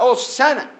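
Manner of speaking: imitated crowd cry, high and loud; grito de la multitud. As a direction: call it out like the multitude. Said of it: "La multitud gritaba". (grito imitado, alto y fuerte)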